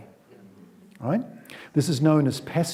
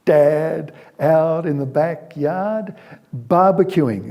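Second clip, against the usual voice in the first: louder, slow and bright; upbeat scene setting; 3 semitones higher, 6 dB louder, slower than usual. A narrator's reading. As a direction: paint the happy scene. Paints happy barbecue scene.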